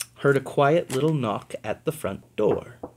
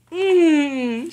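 happy mmm; 14 semitones higher, 5 dB louder than usual. Acted. Tasting beans, high.

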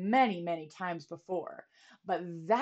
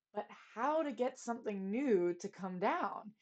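plain reading voice

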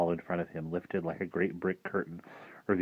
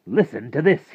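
higher, louder showman call; eager announcement; 8 semitones higher, 10 dB louder than usual. Acted. Rictus at the ringing telephone, eager. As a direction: grab the boy's attention, big and lifted.

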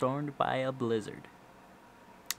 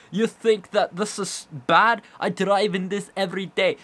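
slow, loud, high put-on Scottish accent; mock a Scottish northerner bragging; hammed-up Scottish brogue, dragged out; 6 semitones higher, 6 dB louder, slower than usual. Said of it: "I don't know what kind of accent that was".

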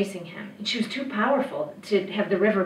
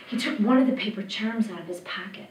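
usual speaking voice

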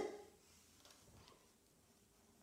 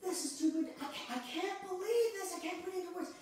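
much higher, breathless disbelief; overwhelmed joy; 8 semitones higher than usual. Retold or imitated. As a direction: sound like joy you can't contain. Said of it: "times we go".

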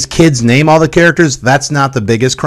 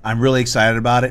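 ordinary talk

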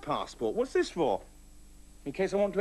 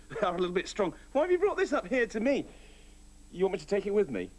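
ordinary talk

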